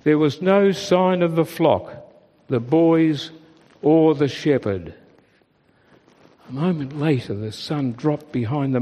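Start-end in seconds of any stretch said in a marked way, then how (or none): none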